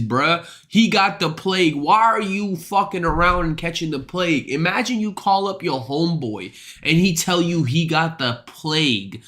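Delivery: normal talking voice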